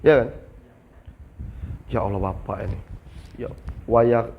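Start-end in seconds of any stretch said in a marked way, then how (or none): 1.8-4.3 understated mock complaint; exasperated customer. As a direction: lower and softer, put-on grumbling. Customer complaining about a small charge.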